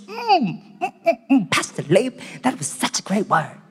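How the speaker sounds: appreciative hums then a mild gush; lightly mocked churchgoer. Churchgoer praising Pastor Leif's sermon, lightly mocking hum. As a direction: hum approvingly like a satisfied pew-sitter.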